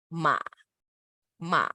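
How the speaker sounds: scoop MA down and back up; dipping third tone. Shown as a demonstration. Teacher modelling the third tone, down then up. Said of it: "third tone is ma".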